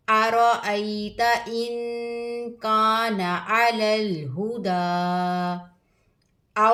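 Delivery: slow, drawn-out melodic recitation; Quran recitation. Acted. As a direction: chant each verse with tajweed. (धीमी क़ुरआन तिलावत)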